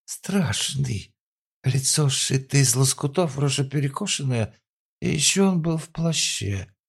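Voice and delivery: put-on high, wailing drunk account; drunk woman voice. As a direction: babble it tipsy and scared. Drunk woman describing attacker.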